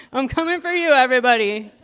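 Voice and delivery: loud playful threat to the whole room; playful shout. Acted. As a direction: call it out to everyone, teasing. Comic shouting out to crowd.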